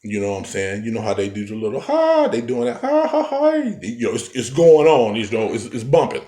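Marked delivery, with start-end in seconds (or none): 1.8-3.5 imitated party chant.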